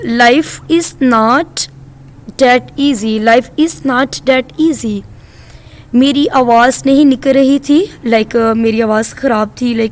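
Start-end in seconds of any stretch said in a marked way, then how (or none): 0.1-5.0 broken into slow chunks for learners; slow teacher demo; very slow model reading. Shown as a demonstration. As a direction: say it slowly, piece by piece. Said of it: "आप बोलेंगे".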